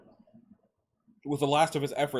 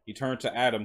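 usual speaking voice

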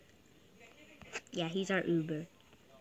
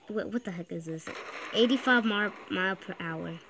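normal talking voice

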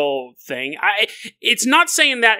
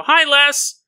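cheery greeting; 8 semitones higher, 4 dB louder than usual. Pretend. Greeting the skeleton.

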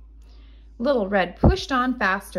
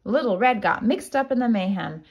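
usual speaking voice

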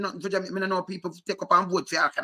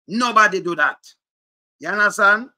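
raised indignant voice; 5 semitones higher, 8 dB louder than usual. Real, not acted. Indignant rant about language.

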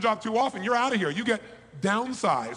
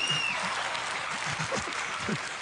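breathy laugh; 3 semitones higher than usual. Real, not acted. Laughs at his own sermon tone.

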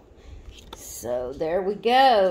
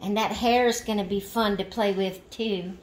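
ordinary delivery

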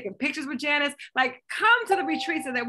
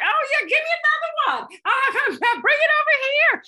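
high, loud, laughing mock greed; imitating an older self calling for another plate, hammed-up and gleeful; greedy delight; 12 semitones higher, 6 dB louder than usual. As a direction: act out ordering more with a laughing high voice. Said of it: "you're like".

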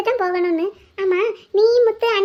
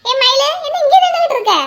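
high, loud hail with a laugh; loud laughing call; 6 semitones higher, 5 dB louder than usual. Acted. Woman hailing Mayilu from afar, loud and laughing. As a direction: call her over, laughing.

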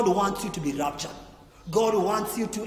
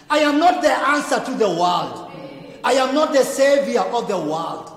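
high, loud emphatic denial; preaching at full force; 4 semitones higher, 8 dB louder than usual. Acted. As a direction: preach it out, leaning on NOT. Preacher climaxes on John's denial.

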